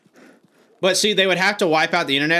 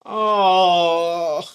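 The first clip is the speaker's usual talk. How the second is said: loud crying oh of despair; pained shout; 4 semitones higher, 4 dB louder than usual. Real, not acted. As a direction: cry out as if it hurts. Reacting to game setback.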